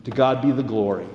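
earnest, rising preacher's cadence; sermon delivery. Acted. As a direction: preach it to the congregation.

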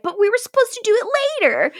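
high, fast whining complaint; hammed-up petulant witch; mock whiny Monique. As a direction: whine it out in a squeak.

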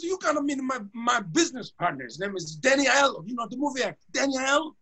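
high, eager name-dropping voice; excited owner. Retold or imitated. Owner insisting the singer meet his partner, high and eager. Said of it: "he goes".

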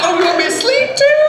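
high, fast, whiny complaint; put-on whiny voice. Elijah whining to the angel, high and fast. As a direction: squeal it like a put-upon prophet.

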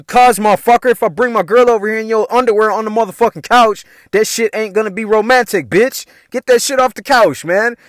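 hammed-up angry comeback to a roommate; retold snapping. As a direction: fire back in exasperation, acted out. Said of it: "I was snapping on".

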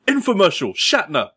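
high-pitched mock call-out; demonstrated yell. Shown as a demonstration. Host showing friends the game's cue, mock yell. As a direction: call out the game words sharply. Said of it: "just yell".

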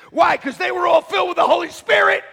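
rising full-voice preacher shout; preaching shout. Real, not acted. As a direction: lift it up with full force. Preacher climax on Holy Spirit.

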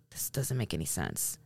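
low, barely voiced retold aside; baffled undertone. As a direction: say it under your breath, baffled. Retold baffled remark under breath.

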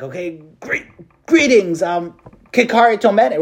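nervous angel. Retold or imitated. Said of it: "again, really nervous".

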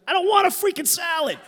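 exasperated through tears and laughter; mock exasperated outburst. Scene: sobbing son waving off the waitress, laughing exasperation. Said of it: "I'm like".

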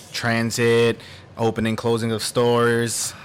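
normal talking voice

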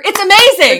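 thrilled, soaring up loud; excited. Real, not acted. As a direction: gush with delight. Gushing about the show's continuity.

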